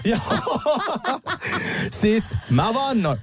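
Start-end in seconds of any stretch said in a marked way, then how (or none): 0.2-2.0 high-pitched burst of laughter. Real, not acted. Podcast host laughing at own remark about lubricant, high laugh. Reacts to own lube joke.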